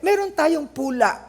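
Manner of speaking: ordinary talk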